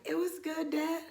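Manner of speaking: small, high, soft answer; timid child. Retold or imitated. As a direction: answer dad meekly, playing innocent. Guilty child answering dad in retelling.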